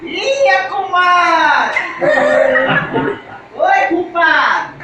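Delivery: slow, stretched neighbourly hello; drawn-out greeting. Acted. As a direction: drag out the greeting.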